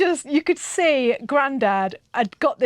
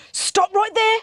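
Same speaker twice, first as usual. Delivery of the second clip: higher-pitched mock order to halt; stern command; 9 semitones higher, slower than usual. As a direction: imitate a grandad stopping a kid dead. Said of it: "like this".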